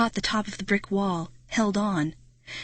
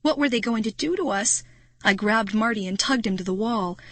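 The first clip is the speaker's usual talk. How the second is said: usual speaking voice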